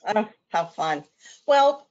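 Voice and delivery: ordinary delivery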